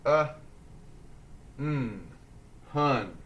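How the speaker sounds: "hun" in separate sounds, Uh, mm, then blended; sounding out. Shown as a demonstration. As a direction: phonics drill on "hun" with long pauses. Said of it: "Roller coasters ready?".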